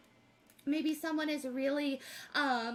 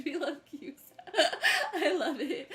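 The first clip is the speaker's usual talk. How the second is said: laughing through the words, louder burst; 5 dB louder than usual. Real, not acted. Reader laughing at a viewer's British queue joke. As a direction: crack up at the joke.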